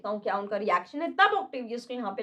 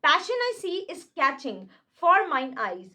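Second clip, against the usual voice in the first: slow, grave Shakespeare reading; dramatic recitation; 4 semitones higher, much slower than usual. Acted. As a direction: declaim each word slowly. Reads Shakespeare lines slowly. (धीमा नाटकीय पाठ)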